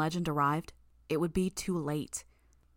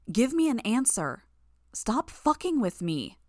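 higher, louder angry demand; raised voice; 6 semitones higher, 4 dB louder than usual. Acted. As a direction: shout at her to answer, the second line slowed. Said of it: "I raised my voice again".

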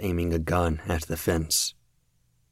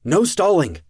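loud, much higher barked command; shouted order; 13 semitones higher, 9 dB louder than usual. A narrator's reading. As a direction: yell her back into line. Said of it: "Kraus shouted".

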